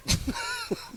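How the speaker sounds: high burst of laughter. Real, not acted. Laughing at the play call.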